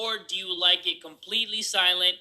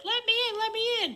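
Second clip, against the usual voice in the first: much higher mock creepy voice; high squeaky imitation; 4 dB quieter than usual. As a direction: squeak it like the girlfriend. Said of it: "she sounds like".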